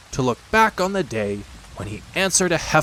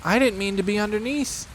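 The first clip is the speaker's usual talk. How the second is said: sad; 11 semitones higher than usual. A narrator's reading. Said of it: "said Piglet sadly".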